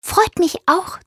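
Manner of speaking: high, relieved little bird; high-pitched friendly bird voice; relieved chirp. A narrator's reading. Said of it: "zwitschert Froni erleichtert".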